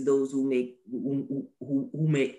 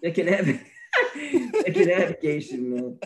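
laughing. Real, not acted. Laughing repeat.

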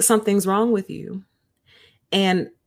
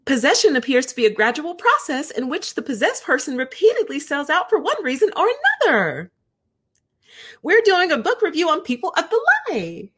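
much higher, bubbly mock-cheery read; fake exclamation-point voice; 10 semitones higher than usual. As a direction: read it like every sentence ends in an exclamation point. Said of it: "the way it would read would be".

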